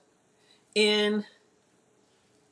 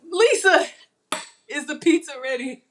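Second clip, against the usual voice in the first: put-on high, loud call-out like a child; impatient kid calling; 6 semitones higher, 6 dB louder than usual. Cook mimicking kids asking Lisa for pizza, high whine. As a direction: pester like a kid waiting for food. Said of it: "Just like the kids".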